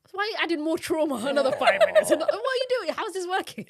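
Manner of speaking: high, loud, laughing disbelief; laughing at the daughter's punishment; laughing mock scold. Mock scolding daughter facing wall.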